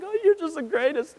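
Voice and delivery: high, gushing fond voice; doting parent. Retold or imitated. Dad forgives his son, high and gushing. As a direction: melt over the kid, pitch way up. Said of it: "and you're like".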